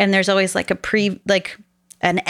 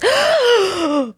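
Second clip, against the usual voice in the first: sharp high gasp, hammed up; mock shocked gasp; imitated big gasp; 3 dB louder than usual. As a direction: suck in air like something awful happened. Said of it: "he just goes".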